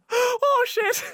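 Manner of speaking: high, loud mock shock; mock alarm. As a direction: squeal in fake alarm.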